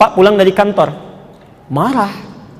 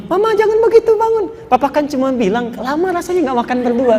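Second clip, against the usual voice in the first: lightly voiced husband, high and coaxing; gentle husband; 6 semitones higher, 4 dB quieter than usual. Husband lifting his crying wife, high gentle coaxing. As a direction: coax her up off the floor, lightly.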